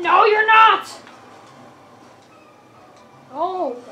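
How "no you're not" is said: sudden loud "No, you're not!", much higher; emphatic shout. Real, not acted. Gamer yelling at an enemy unit, loud. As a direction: bark the denial at the screen.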